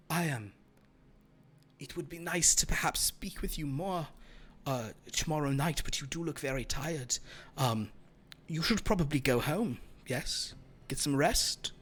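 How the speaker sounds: soft, courteous vampire; soothing vampire. Acted. Vampire urges tired Dahlia to go home, soothing. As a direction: speak quietly, gently sending someone off to rest.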